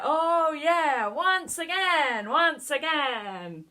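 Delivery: loud barking market stall shout; market trader voice. Shown as a demonstration. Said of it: "do it in a market trader voice".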